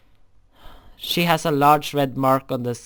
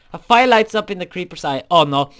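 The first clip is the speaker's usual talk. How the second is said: ordinary talk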